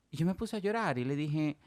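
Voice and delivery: usual speaking voice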